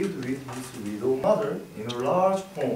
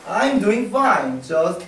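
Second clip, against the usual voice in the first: put-on a touch of a cocky young frog, louder; lightly voiced rascal son; cheeky son; 5 semitones higher, 6 dB louder than usual. A narrator's reading. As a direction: brush off mother lightly. Little Frog answers cheekily.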